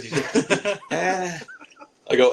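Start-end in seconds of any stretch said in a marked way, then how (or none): 0.9-1.4 high imitated "ah"; wordless noise. Little bellboy who speaks no English, high wordless noise. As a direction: mimic him with a raised, wordless sound. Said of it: "he's not saying any words".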